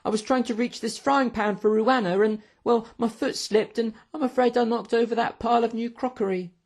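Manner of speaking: high-pitched waiter making excuses; young waiter. A narrator's reading. Waiter explaining the crash to his boss, apologetic. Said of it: "said a young waiter".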